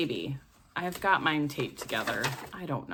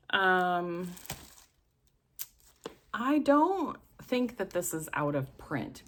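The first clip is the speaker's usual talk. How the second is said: ordinary talk, nothing marked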